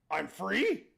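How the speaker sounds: disbelief bursting into a high, loud cry; astonished genie. Acted. Genie finds himself free of the lamp, astonished. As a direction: question it, then explode in amazement. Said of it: "he's looking around, goes".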